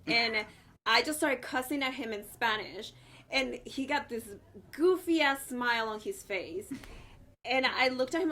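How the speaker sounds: ordinary talk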